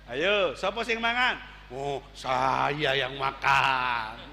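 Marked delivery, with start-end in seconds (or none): 1.7-4.2 low, slow sly confession; greedy disciple. Acted. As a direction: own up now that gold is offered.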